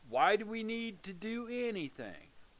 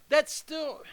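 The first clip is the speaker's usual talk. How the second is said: normal talking voice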